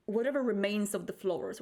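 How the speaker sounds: normal talking voice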